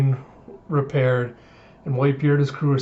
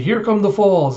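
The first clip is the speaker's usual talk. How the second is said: raised, higher warning call; alarmed warning; 6 semitones higher, 4 dB louder than usual. Retold or imitated. Sailor warning the ship of the falls, alarmed. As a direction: shout a mock alarm to the deck.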